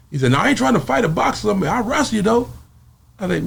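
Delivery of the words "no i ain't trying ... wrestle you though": Debo's voice. Retold or imitated. Debo refusing to box.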